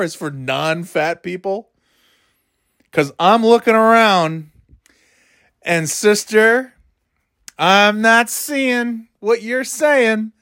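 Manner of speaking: high, slow, sassy comeback. Real, not acted. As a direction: throw attitude, drawn out. Sassy rant at a sister.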